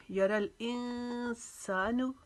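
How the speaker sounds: यारल इंसानु chanted very slowly, the nasal न hidden and held one alif; ikhfa recitation. Shown as a demonstration. Teacher shows students ikhfa in slow chant. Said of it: "इक्फ़ा का कायदा बनेगा".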